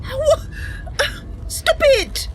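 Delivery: high, panicked cry of alarm; alarmed heroine. Acted. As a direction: plead frantically as your friend is attacked. Role-playing shocked character.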